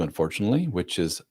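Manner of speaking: ordinary talk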